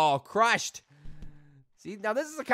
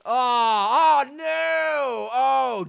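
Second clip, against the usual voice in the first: loud, high drawn-out mock lament; hammed-up wail; 8 semitones higher, 10 dB louder, much slower than usual. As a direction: wail in exaggerated dismay. Wails as Timmy falls.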